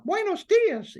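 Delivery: Mickey Mouse voice. Shown as a demonstration. Showing how Mickey sounds in Spanish, high loud falsetto. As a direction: squeak "Buenos dias" like Mickey. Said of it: "it's still Mickey saying that".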